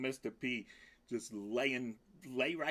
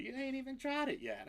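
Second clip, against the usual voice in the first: high squeaky voice, a bit fast, for the cat; high sassy pet voice; cat's sassy reply; 11 semitones higher than usual. Pretend. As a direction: talk back as the cheeky cat. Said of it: "It's like".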